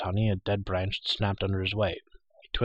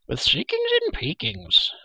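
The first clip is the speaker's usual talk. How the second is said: very high, louder, stretched creature squeal; Gurgi crying out; 5 dB louder, slower than usual. A narrator's reading. Creature boasts of his scouting, excited high cry. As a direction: squeal it slowly, far up high. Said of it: "he cried".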